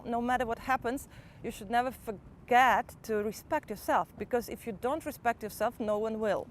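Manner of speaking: ordinary talk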